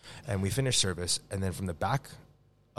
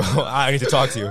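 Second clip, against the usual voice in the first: mock dick-first talking; 3 semitones higher, 8 dB louder than usual. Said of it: "Pull the dick out and go".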